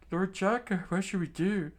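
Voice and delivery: high, pleading guard voice; anxious guard. Acted. Guard begging Lord Chaka for orders, high and anxious. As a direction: ask for orders in a thin worried voice.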